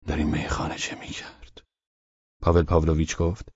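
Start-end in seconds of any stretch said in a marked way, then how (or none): 0.1-1.6 low, hushed inner voice; asking himself. A narrator's reading. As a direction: drop to a murmur, as if thinking. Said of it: "ولچانینوف از خود پرسید". (زیر لب، از خود پرسیدن)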